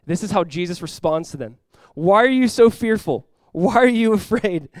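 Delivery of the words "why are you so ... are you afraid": raised, loud, slow questions; challenging. Acted. Jesus responding to fearful disciples, loud challenge. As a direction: press each question slowly and loudly.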